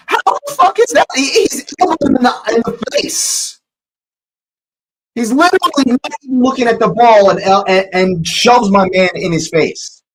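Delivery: high-pitched, loud outburst at the foul; outraged shout. Real, not acted. Fan screaming at the screen over the elbow, outraged shout. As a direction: yell in disbelief at the elbow.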